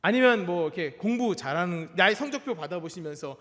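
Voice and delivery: ordinary talk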